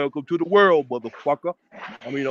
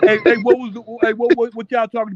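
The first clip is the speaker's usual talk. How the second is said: raised, eager hey to cut in; calling out; 6 semitones higher, 4 dB louder than usual. Real, not acted. Panelist calling out to the others to be heard, eager raised voice. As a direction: pitch up and push to get the floor.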